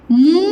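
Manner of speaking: मुनि with both vowels stretched far out; मुनि as a drawn-out "muuu... niii"; wrong long vowels. Shown as a demonstration. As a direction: drag out मुनि syllable by syllable, loudly. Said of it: "एकदम गलत हो जाएगा".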